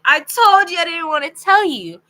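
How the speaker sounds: high, loud imitated protest; exclaimed protest. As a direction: act out an exasperated I-told-you-so. Date protests after his confession.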